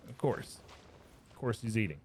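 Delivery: ordinary talk, nothing marked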